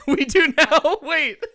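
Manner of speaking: high laughing shout. Real, not acted. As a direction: burst out laughing. Laughing about lighting cake.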